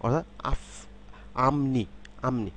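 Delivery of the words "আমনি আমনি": "আমনি" with a nasal m before নি; assimilated m sound. Shown as a demonstration. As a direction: model "আমনি", প becoming m. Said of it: "ম হয়ে যাবে".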